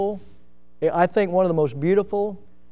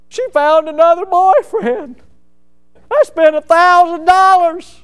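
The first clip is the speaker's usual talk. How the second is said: crying; 12 semitones higher, 10 dB louder than usual. Retold or imitated. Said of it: "He was just crying".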